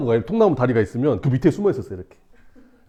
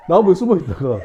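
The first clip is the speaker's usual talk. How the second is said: plain reading voice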